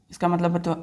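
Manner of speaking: ordinary delivery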